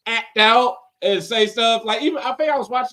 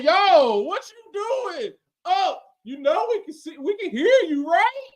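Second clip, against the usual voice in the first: incredulous commenters; 9 semitones higher than usual. Retold or imitated. Mock viewers warning the live streamer.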